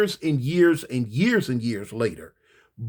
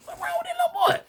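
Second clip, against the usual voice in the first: outraged high yell at the mother; incredulous shout; 4 dB quieter, faster than usual. Retold or imitated. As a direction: shriek the question. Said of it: "lady, why you smiling".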